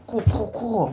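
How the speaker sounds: high imitated kick noises; kicking sound effects.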